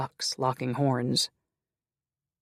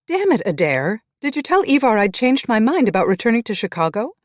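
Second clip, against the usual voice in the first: louder and higher, furious at her mate; angry outburst; 9 semitones higher, 8 dB louder than usual. Acted. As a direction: burst in yelling at him. Woman stomps in, furious.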